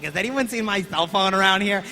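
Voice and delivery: a hammed-up woman groping for the phone; mock blind woman. Woman coughing blood, gone blind.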